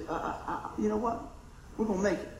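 put-on soft, hushed comfort to a scared child; reassuring parent. Parent softly reassures worried kid, quiet and tender. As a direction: hush them gently, it'll be all right.

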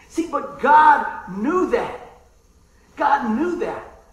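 slow, loud emphasis on God knew that; slow, loud preacher's emphasis; emphatic preaching. Real, not acted. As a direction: drive it home slowly and loudly. Preacher emphatic point to congregation.

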